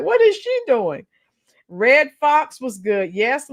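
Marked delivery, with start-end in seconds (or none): 0.0-1.1 baffled delivery guy. Retold or imitated. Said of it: "he probably like".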